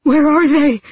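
high, loud frantic question; screamed demand. A narrator's reading. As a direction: shout it in desperation. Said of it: "he screamed".

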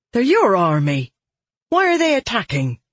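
alarmed, high woman's voice. Acted. Holly alarmed.

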